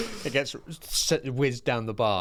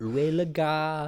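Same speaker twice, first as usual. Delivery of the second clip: schoolbook French; 5 semitones higher than usual. Retold or imitated. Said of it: "G C S E French".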